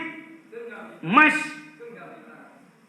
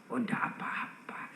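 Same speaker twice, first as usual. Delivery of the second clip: whisper; 9 semitones lower, 13 dB quieter than usual. Retold or imitated. Sunan Kalijaga answers the walis.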